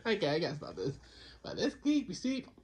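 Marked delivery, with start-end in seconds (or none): 1.4-2.5 higher, hammed-up vampire lilt; lightly voiced Dracula leaning on creepy; Count Dracula voice. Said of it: "Why am I sound like Count Dracula?".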